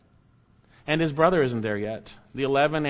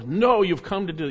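higher, louder harsh retort; 6 semitones higher, 4 dB louder than usual. Acted. Disguised Joseph harshly rebuffing his brothers, loud retort. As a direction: cut them off harshly. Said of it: "He's being harsh in the way he's coming over".

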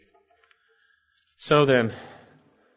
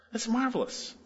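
high wonder; 9 semitones higher, 9 dB quieter than usual. Real, not acted. Pastor marvels at full pardon.

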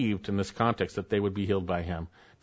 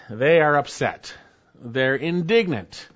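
ordinary talk, nothing marked

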